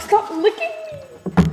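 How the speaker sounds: very high and slow, drawn out; to the cat licking her legs, high drawn-out whine; shrill protest. Retold or imitated. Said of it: "I'm like".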